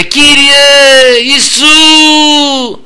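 high, long-held shout to Jesus; crying out. Shown as a demonstration. Preacher shows how Bartimaeus called to Christ, drawn-out cry. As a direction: call out with the last vowel held long. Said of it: "φώναξε".

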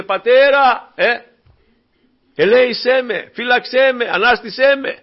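high, loud pleading cry to the Father. Acted. Preacher voices Christ crying out to the Father, loud pleading. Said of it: "Με τα κραυγής δυνατής και δακρύων φώναζε".

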